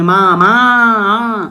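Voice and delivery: मामा with the final आ stretched very long and slow; drawn-out final aa. Shown as a demonstration. Teacher to students, showing the long आ ending, stretched slowly. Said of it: "आ निकल रहा है अंत में".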